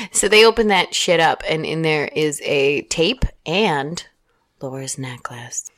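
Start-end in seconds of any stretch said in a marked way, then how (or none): 4.6-5.7 slow hushed reveal; whispering. Acted. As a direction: whisper it slowly for suspense.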